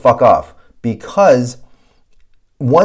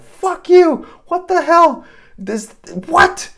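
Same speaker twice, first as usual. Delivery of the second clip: much higher, louder indignant cry; outraged outburst; 4 dB louder, slower than usual. Retold or imitated. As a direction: shriek at the absurd handoff.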